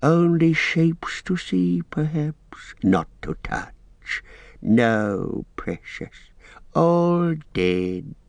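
high, hissing, slow creature voice; wheedling Gollum. Acted. Gollum voice in audiobook.

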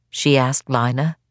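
ordinary delivery

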